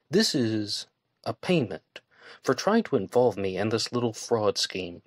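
ordinary delivery

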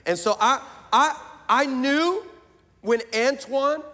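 ordinary talk, nothing marked

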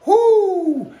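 high, loud "whoo"; excited whoop. Real, not acted. Coach whooping mid-workout, excited. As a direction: let out a piercing hyped-up whoop.

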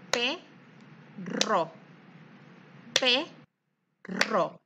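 "perro" split slowly into "pe" and "rro"; syllabifying perro. Shown as a demonstration. Teacher to children, spelling out "perro" syllable by syllable. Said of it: "cuáles son las sílabas de la palabra perro".

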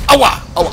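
high loud ouch, ouch; pained yelps. Pretend. Player's character hits knee, loud high ouch. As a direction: yelp like you banged your knee.

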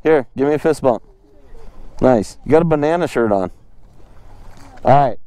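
ordinary talk

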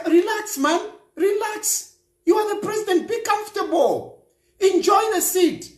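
high-pitched urging to relax; mock reassurance. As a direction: urge him, pitched well up. Mock-advising the frightened president to relax.

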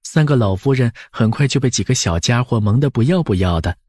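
ordinary delivery, nothing marked

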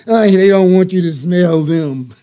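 talking loud. Retold or imitated. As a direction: say it to the dog but for them to hear. Neighbour to her dog.

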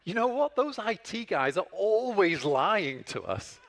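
the client happily blames IT loudly; indignant exclamation. Retold or imitated. Relieved client blaming IT.

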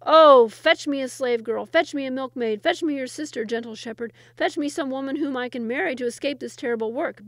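loud, desperate begging. Acted. Sultan begs the shepherd for a wife, loud and frantic. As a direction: beg frantically for any wife. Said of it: "begged Mahmud".

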